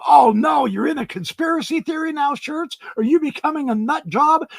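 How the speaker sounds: high-pitched mock alarm; mocking critics. Critics fret over the host joining this field, mock alarm. Acts out critics' worried questions.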